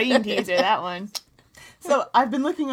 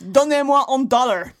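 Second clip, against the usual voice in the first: loud, higher, hammed-up French; bad French accent; 4 semitones higher, 7 dB louder than usual. Host begs a dollar in French, badly faked accent. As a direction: belt it out in phony French. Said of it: "I don't know if I'm selling it".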